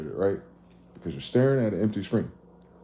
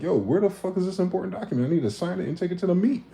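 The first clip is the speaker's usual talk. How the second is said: higher, hurried demanding voice; impatient caller; 10 semitones higher than usual. Retold or imitated. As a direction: chase them down on the phone. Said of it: "the guy calling me".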